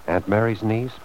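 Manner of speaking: ordinary talk, nothing marked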